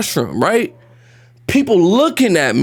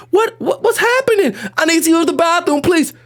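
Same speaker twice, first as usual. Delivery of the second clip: put-on high, panicked child's plea; hammed-up desperate kid voice; pleading first-grader; 9 semitones higher than usual. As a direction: beg in bewilderment for the bathroom. Acted child pleading with nurse.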